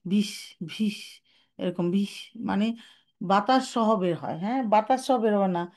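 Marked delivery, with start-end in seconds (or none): 0.0-2.1 ভিস breathed out with air; breathy wrong ba; airy whispered ba. Shown as a demonstration. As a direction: let air hiss through ভিস. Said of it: "বায়ের মধ্যে whispering চলে আসে".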